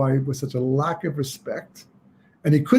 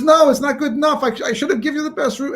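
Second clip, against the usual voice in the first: much higher, pleading insistence; distraught insistence; 11 semitones higher, faster than usual. Acted. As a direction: refuse comfort, near tears. Innkeeper refuses to be consoled.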